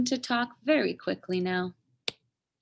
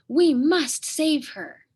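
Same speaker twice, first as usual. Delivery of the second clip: slow, loud and resolute; rally the sisters to the rescue; determined call; 6 semitones higher, 7 dB louder than usual. Acted. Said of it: "cried first sister".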